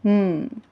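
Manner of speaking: "mm" hummed as one held sound; letter sound M. Shown as a demonstration. Teacher showing pupils the beginning sound, "mm" hummed. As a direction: hold "mm" with closed lips. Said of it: "और इसका sound है".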